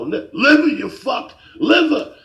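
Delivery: high, loud mock shout of an old man; furious customer. Old man cursing the clueless sandwich clerk, furious. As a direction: yell it as an enraged customer. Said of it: "I can see his face getting red".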